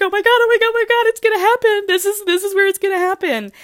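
high, fast, breathless squealing; excited squeal. Retold or imitated. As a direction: gush like a starstruck fan. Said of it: "We're like".

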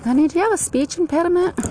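very high, quick mock concern; retold motherly question. Mother asking her son about his speech, high mock concern. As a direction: pitch it right up, asking sweetly fast.

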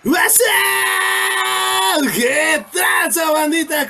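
shouted radio hello, slow on tranza; loud hype greeting. Real, not acted. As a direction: shout it out to the crowd. Loud greeting on joining show.

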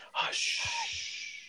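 soft, breathy high Hush. Shown as a demonstration. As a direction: whisper Hush in a thin voice. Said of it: "You have to hush when you say it".

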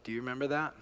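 ordinary delivery

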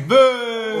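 high, loud playful yell; mock yell. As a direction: blurt out a silly yell. Mock yell at guest's greeting.

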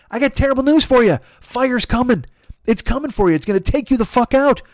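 high-pitched hammed-up indignant rant; mock outrage. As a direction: rail at the fires like a spoiled city dweller. Comic rant against the fires.

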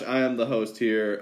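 usual speaking voice

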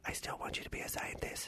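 whispered dad voice; father. Acted. Dad confiding his wish to his son, whispered. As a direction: drop to a very soft whisper, as a father. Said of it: "I'm gonna play your dad".

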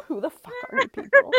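high, laughing mock outrage; mock baffled stranger. Host retelling the comic artist's reaction, high and laughing. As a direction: play a confused stranger while cracking up. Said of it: "We're like".

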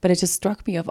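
ordinary talk, nothing marked

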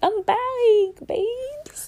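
tiny high squeak, fading to a whisper; squeaky. Pretend. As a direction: squeak it cutely then breathe the last word. High playful squeak to viewers.

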